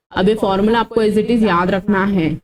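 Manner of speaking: ordinary talk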